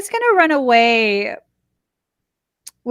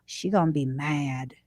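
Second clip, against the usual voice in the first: spirit guides' voice; 9 semitones lower, 10 dB quieter than usual. Retold or imitated. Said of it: "standing around his lifeless body like".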